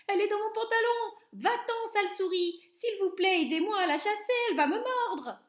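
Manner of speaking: high, loud, fast frantic pleading; panicked shouting. A narrator's reading. Silly cook pleading with the others, fast high loud panic. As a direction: beg for help in a frenzy. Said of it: "le stupide cuisinier crier encore".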